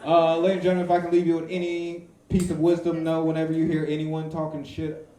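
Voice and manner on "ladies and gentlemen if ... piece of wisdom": ordinary talk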